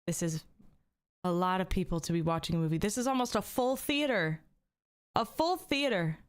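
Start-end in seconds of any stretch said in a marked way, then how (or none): 2.8-6.2 high, amazed full theater; excited. Real, not acted. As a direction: marvel at the big crowd. Excited at viewer count.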